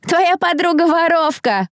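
high, slow, drawn-out jeer; gleeful taunt. A narrator's reading. Jeerer in the crowd to accused girl's friend, gleefully taunting. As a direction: call it out merrily to taunt. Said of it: "услышала я весёлый голос".

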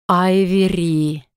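slow, drawn-out call of a nightmare demon; eerie demon call. A narrator's reading. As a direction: stretch the name out as a demon luring its prey. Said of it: "этот жуткий голос". (жуткий протяжный зов демона)

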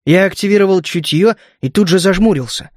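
plain reading voice

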